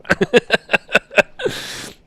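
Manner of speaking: high, loud burst of laughter; hearty laugh. Real, not acted. Storyteller amused by friend dropping everything for pastries, laughing. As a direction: crack up at your own punchline.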